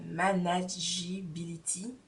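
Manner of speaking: the word manageability read slowly in a put-on heavy French accent; mock French accent. Stumbling over a label word, in a mock French accent, slowly. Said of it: "je fais comme si mon accent n'est pas au top".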